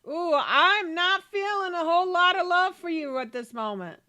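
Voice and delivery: loud, high-pitched acted complaint; hammed-up loud protest; mock indignation. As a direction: start high and loud, playing up the grievance. Voicing quarrelling Sims couple.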